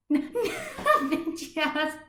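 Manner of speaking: giggling through the words; breathy giggle between words; laughing. Acted. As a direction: laugh out the answer. Laughing character answers.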